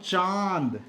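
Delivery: चांद with a long nasalized आ; चांद, held and nasal; nasal vowel. Shown as a demonstration. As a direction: send the vowel of चांद into the nose. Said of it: "आ रहा है ना नाक पे".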